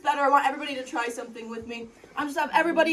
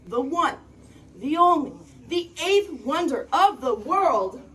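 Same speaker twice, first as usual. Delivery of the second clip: building to a big booming reveal; grand announcement; 4 semitones higher, 7 dB louder, slower than usual. Acted. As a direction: announce it like a showman.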